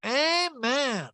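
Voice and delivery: high, hammed-up Amen; mock Amen. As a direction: sing it like church. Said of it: "And Eric goes".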